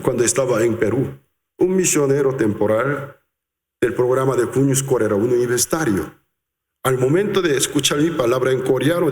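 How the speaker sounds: ordinary delivery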